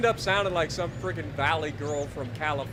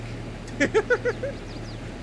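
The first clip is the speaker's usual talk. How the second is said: high breathy giggle. Real, not acted. Laughing at own girl voice.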